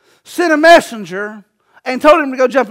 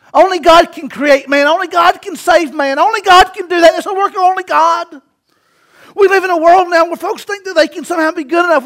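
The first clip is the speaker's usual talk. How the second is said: raised, insistent pulpit voice; full-force preaching; 4 semitones higher, 4 dB louder than usual. Real, not acted. Preacher insisting only God can save man, full-force preaching. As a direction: drive each repeated phrase up and out.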